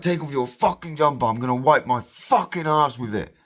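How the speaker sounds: squaring up, daring him; furious demand. Retold or imitated. Retold confrontation.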